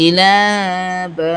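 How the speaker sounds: "ইলা বা" with both "লা" and "বা" held; slow recited "ইলা বা", long endings; one-alif elongation. Shown as a demonstration. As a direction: draw out each a vowel in "ইলা বা". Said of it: "এক আলিফ পরিমাণ টান হচ্ছে".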